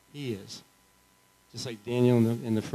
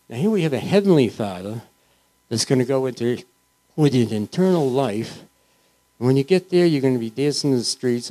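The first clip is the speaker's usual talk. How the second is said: rising preaching; 5 dB louder than usual. Acted. Preaching heaven joyfully.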